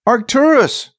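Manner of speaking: high-pitched desperate call; belted out. A narrator's reading. Thief lost alone calling his companion, belted out. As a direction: belt the name out into the dark. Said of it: "he belted".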